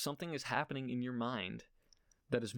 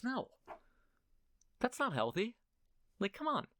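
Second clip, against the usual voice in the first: half-joking yell; 12 semitones higher than usual. Real, not acted. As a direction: yell it at yourself, fed up. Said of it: "don't know who I'm yelling at".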